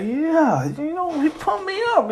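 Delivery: high-pitched pumped-up chatter; excited student. Acted. Excited student reacts to speech.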